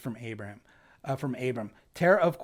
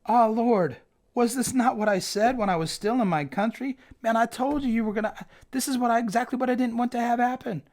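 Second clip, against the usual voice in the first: high, pleading complaint to God, getting faster; whiny Jonah; 8 semitones higher than usual. Acted. Jonah complains to God about Nineveh, high and whiny. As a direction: play Jonah griping that he saw this coming. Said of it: "He prayed to the Lord and said".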